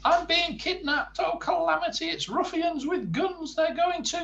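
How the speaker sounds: high, loud, frantic wailing; panicked wailing. Acted. Mister Jelly wails that ruffians with guns have him, high and frantic. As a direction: wail in total panic.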